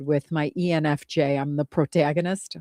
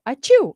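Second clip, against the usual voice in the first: loud high fake sneeze; imitated sneeze; 8 semitones higher, 8 dB louder than usual. Shown as a demonstration. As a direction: sneeze it out sharply. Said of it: "I just go".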